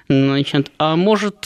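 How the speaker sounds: ordinary delivery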